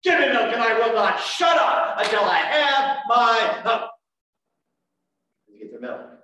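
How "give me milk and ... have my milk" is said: put-on loud, high bawling baby demand; be the hungry newborn who won't wait. Pastor voicing a newborn shouting at mama for milk. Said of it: "to communicate to mama".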